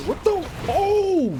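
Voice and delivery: high, loud startled cry; shocked outburst. Real, not acted. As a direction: blurt it out in shock. Startled by game event.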